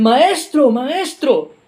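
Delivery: high, loud panicked plea; frightened cry. Acted. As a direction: cry out to the master as the boat sinks. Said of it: "le gritan a Jesús".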